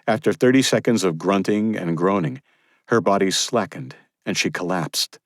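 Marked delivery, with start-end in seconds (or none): none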